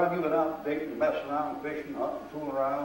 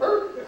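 sudden high strained grunt like a sore stomach; mock ulcer groan, pitched way up; imitated ulcer groan; 4 dB louder than usual. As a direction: squeeze out a high pained "er". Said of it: "That ulcer goes".

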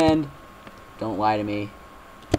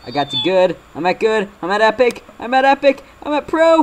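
rising higher and louder each time; building excitement; 10 semitones higher, 7 dB louder than usual. Real, not acted. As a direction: climb in pitch with each rank. Reaching higher ranks.

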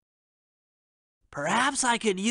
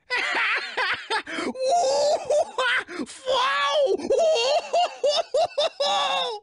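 long high shrieking laugh fading out; long squealing laughter, almost crying. Acted. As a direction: laugh helplessly until the breath runs out. Interrogated with a little of this.

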